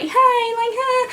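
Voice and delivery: high, sing-song teasing hey; mocking greeting. The girls taunt the lone bully with a mocking hey. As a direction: tease him with a fake friendly hey. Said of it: "we all sit around him like".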